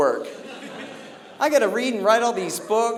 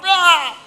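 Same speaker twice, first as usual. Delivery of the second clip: loud high raging yell; enraged scream; 11 semitones higher, 8 dB louder than usual. Retold or imitated. Raging driver screams in his truck. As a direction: let out a furious scream. Acting out the rage.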